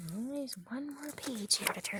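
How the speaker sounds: hushed unvoiced whisper. Real, not acted. Reader whispering the refrain to the children. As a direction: keep it to a secret hush.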